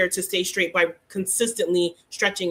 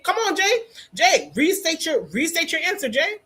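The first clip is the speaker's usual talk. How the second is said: insistent prompting; 7 semitones higher than usual. Real, not acted. Host calling on student to restate.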